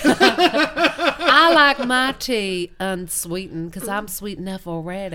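hammed-up genteel Southern drawl; drawn-out mock Southern belle; fancy Southern accent. Englishman tries fancy Southern, drawled and hammed up. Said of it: "fancy Southern accent".

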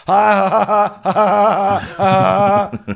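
loud, high forced "ha ha ha"; overdone fake laugh. [name] laughing too hard at a joke, overdone mock laugh. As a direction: imitate someone laughing far too hard. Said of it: "you laughed a little bit too hard".

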